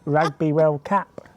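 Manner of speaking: "Rugby World Cup" said slowly in a mock British accent, "Rugby" as rahgby and "Cup" as cahp; a drawn-out British "Rugby World Cup"; imitating how the British say "Rugby World Cup". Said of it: "they're like".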